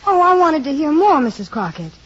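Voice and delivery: loud, pleading outburst; eager pleading. Acted. Girl begging to keep listening.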